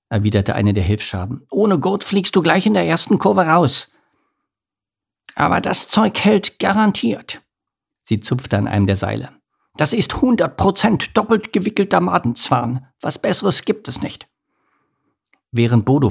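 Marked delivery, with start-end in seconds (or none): 1.5-7.4 high-pitched cockroach character voice; brusque helper cockroach. A narrator's reading. Helper cockroach strapping Bodo in, brusque. 9.8-14.3 high-pitched roach character voice; boastful helper cockroach. A narrator's reading. Helper cockroach vouching for the thread, boastful.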